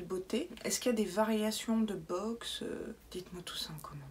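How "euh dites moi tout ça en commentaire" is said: whisper. Real, not acted. Hushed quick aside.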